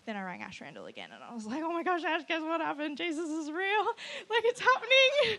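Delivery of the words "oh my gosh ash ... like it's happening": high, loud, squealing excitement; excited phone news. Retold or imitated. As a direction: burst with amazed news, building to a squeal. Retold excited phone call to friend.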